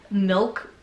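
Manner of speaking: ordinary delivery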